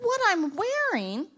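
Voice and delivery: high-pitched flustered questioning; baffled protest. Acted. Defensive, baffled about her outfit.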